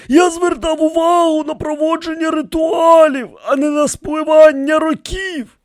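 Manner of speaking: very high character voice; high priest explaining himself. A narrator's reading. High priest defends himself to the pharaohs, pleading. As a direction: plead the excuse in a thin, high register.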